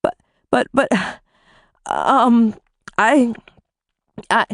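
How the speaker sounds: halting mumbled starts; can't get the words out; mumbling. Acted. Wife mumbling helplessly to her husband. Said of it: "I mumbled".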